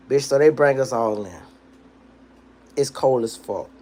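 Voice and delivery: usual speaking voice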